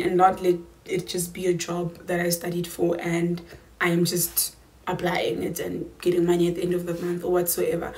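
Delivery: ordinary delivery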